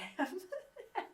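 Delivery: a high, bright laugh breaking out; burst of laughter. Real, not acted. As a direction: laugh at the memory of chasing him. Laughing at her own chase.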